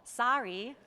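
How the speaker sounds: "Sorry" as "sah-ree", open A; American vowel. Shown as a demonstration. Coach modelling American for interviewer, "Sorry" as "sah-ree". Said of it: "It's almost like S A".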